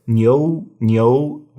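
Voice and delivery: "neo" run together as one syllable, nyoh; said fast. Shown as a demonstration. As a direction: glide quickly through "neo" as a single nyoh. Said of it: "you just say it really fast".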